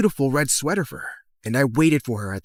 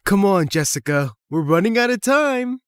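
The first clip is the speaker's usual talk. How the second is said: raised voice climbing high at the end; calling out; 6 semitones higher, 4 dB louder than usual. Acted. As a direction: hurry a friend along from across the hallway. Calls friend away.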